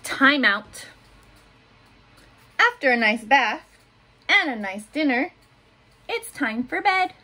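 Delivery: put-on gentle picture-book reading for little kids; reading to children. A narrator's reading. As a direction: read it like story time in class. Teacher reads picture book aloud.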